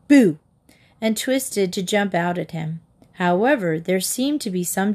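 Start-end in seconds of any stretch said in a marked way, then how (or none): 0.1-0.4 loud sudden Boo; playful scare shout; yelled boo. A narrator's reading. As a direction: jump out and shout it. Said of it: "Katy yelled".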